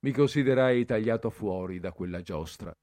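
plain reading voice

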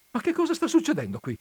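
high, fast startled cry; alarmed. A narrator's reading. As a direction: shoot up in sudden alarm. Alarm interrupts father.

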